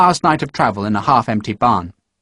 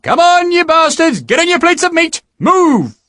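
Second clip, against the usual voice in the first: high, loud barked order; drill shout; 4 dB louder than usual. Acted. Sergeant rousing the marching men, high loud shout. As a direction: bark it at the men.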